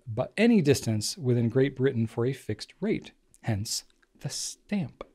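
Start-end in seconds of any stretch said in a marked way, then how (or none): 3.4-5.0 slow dramatic whisper. Acted. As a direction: hush it like a reveal.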